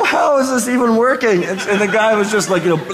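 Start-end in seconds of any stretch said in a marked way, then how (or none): 0.0-1.4 higher, incredulous wonder; amazed disbelief. Retold or imitated. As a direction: ask it in laughing disbelief. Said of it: "and I go".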